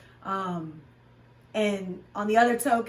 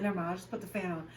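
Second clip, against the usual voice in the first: quick resigned mumble; 2 semitones lower, 9 dB quieter, much faster than usual. Retold or imitated. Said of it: "I'd be like".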